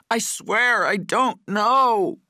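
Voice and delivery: screaming oath, very high and loud; agonized scream. A narrator's reading. Patrick swears to his torturer, screaming in pain. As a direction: stretch the words into a pained scream. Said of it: "Patrick screamed in pain".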